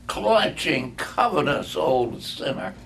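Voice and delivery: ordinary delivery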